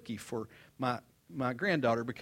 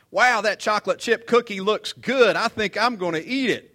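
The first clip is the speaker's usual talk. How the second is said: put-on loud, higher playful taunt to tempt the child; teasing loud; 7 semitones higher, 10 dB louder than usual. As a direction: dangle the cookie, teasing. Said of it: "I said it like that".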